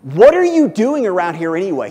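put-on loud, sharply rising outburst of a scolding older brother; indignant older-brother rebuke, read out loud; angry demand. A narrator's reading. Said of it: "he was angry".

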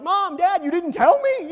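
high, very loud outburst; upset kid yelling. Retold or imitated. Upset kid to Mom and Dad, high outraged yell. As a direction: yell it in hammed-up childish outrage. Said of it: "I'm upset about this".

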